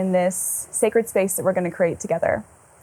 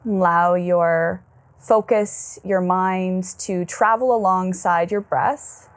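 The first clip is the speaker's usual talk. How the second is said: slow, settled meditation voice; guided meditation. Acted. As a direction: ease them inward with long, calm pauses.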